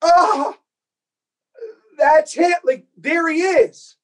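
gasping as you find the one; awed realization. Retold or imitated. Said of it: "I went".

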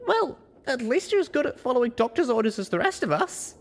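high-pitched pony character voice; high, chipper storybook character; young pony heroine. Acted. Voices the story's narrator-protagonist.

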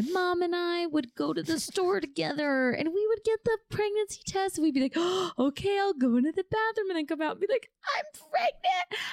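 high gushing daydream voice; dreamy excited. Retold or imitated. Imagining telling her mom, high excited gush. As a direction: gush over the pictured moment.

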